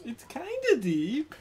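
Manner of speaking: squeaked up high and slow; squeaky high voice. Retold or imitated. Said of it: "as high as Lane could go".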